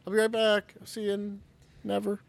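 voice fading away. Acted. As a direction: call back as if leaving, fading on the last word. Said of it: "As his voice fades".

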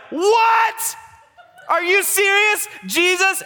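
very high, loud squeal of shock; hammed-up astonished disciples; mock disbelief. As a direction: shoot the voice sky-high in comic disbelief. Other disciples react to the news.

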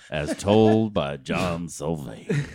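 low, slow storyteller's close; mock narrator sign-off. Co-host closing his pal's story, mock-solemn narrator. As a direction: intone it like closing credits.

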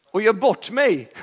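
sheepish laughing admission. Real, not acted. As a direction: laugh it out at the embarrassment. Laughs at own fall.

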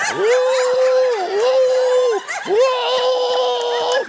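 repeated loud high wails; ecstatic church whoops. Retold or imitated. Imitating the meanest man overcome in church, whooping. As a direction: hoot wildly, holding each one. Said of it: "He goes".

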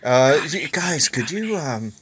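chirpy hard sell to friends; pushy salesman pitch. Retold or imitated. Mock salesman inviting early.

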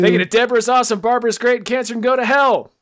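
loud, high shout-out read; hyped announcer. Acted. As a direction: announce each name like a hype man. Hyped roll call of producers.

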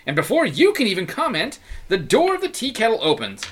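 ordinary delivery

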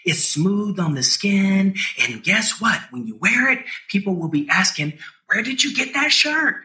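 upbeat, high ad voice; ad read. Acted. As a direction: pitch the merch with hype. Reads a merch ad.

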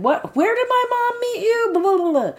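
high, put-on nosy voice; mock prying brother. Brother grilling Rain about his mom, high and nosy. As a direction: squeak it out like a snoop.

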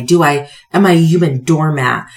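ordinary talk

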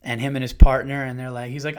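ordinary talk

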